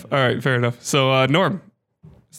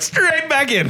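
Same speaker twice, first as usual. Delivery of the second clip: much higher, drawn-out exclamation; eager mock cheer; 13 semitones higher, slower than usual. Host voices a player diving back into Zelda, eager high cry. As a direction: pitch it way up, imitating an eager player. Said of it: "and you're like".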